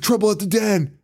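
high, urgent call; high, breathless alarm, hammed up; urgent nephew. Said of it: "he's like".

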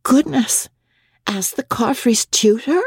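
high, surprised wife's voice; wife, bewildered, asking in a high voice. A narrator's reading. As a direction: rise up in puzzled disbelief.

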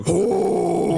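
high put-on twangy "oh"; twang. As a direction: sing out a twangy oh. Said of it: "you just go like".

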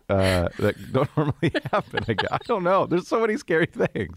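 ordinary talk

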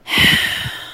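long, heavy exhale of a let-down father; deep disappointed sigh. Retold or imitated. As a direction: sigh like a dad let down again. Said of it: "he just always does a deep sigh".

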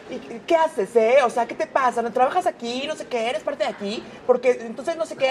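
high mock scolding of the staff; rude actress imitation. Friend imitating the rude actress, high mock scolding. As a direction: play the snooty star telling them off, pitched high. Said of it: "muy grosera".

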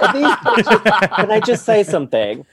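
slow, high, loud outraged squeal; indignant quote. Retold or imitated. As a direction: act scandalised, stretching words. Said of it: "I was like".

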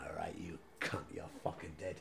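hushed, breathy whispered threat; low whispered menace. Retold or imitated. Retold threat to police.

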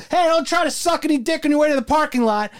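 high, loud mock yell after her; hammed-up jealous boyfriend shouting across the room; angry boyfriend yelling. Retelling Clerks, the jealous boyfriend yells at his girlfriend as she leaves. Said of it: "She fucking walks out the door".

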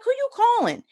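very high-pitched acted question; alarmed retort. Wife questions frantic husband, high alarmed voice. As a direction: ask it in a high, acted panic.

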